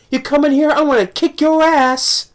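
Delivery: a hammed-up tough-guy dare; tough kid quote. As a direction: act tough like a cornered kid. Acting tough, quoting the kid.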